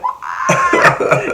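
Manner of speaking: high mock cry of pain; family reacting to extra spicy taco sauce. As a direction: yelp like your mouth is burning. Family burned by spice.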